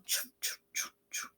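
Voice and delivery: whispered choo choo; soft footsteps. Acted. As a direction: breathe it out softly like footsteps on dirt. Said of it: "They make a soft sound".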